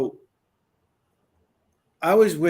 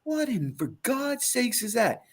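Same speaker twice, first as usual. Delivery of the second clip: amazed; 8 semitones higher than usual. Retold or imitated. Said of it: "And I'm like".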